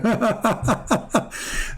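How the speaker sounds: high-pitched staccato chuckle; hearty laugh. Real, not acted. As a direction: laugh in a run of high bursts. Laughs at his own joke.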